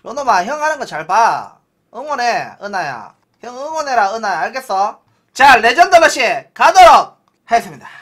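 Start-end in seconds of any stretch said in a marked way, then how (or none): 5.3-7.9 loud hype announcement. Real, not acted. Announces legend rush loudly.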